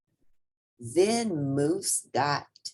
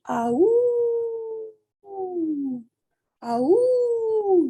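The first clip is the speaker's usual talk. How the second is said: loud high howling ah ooh; dog howl; 5 dB louder, slower than usual. Acted. Moose the dog howling alone, loud and high. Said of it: "yelled Moose".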